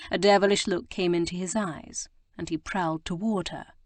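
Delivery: usual speaking voice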